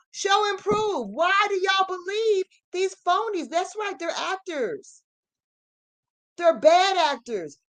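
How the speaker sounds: high, pushed challenge, voice straining up; exasperated rant. Real, not acted. Addressing online followers of phonies, a high indignant rant. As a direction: demand answers, disbelieving and fed up. Ranting at fake alloidial groups.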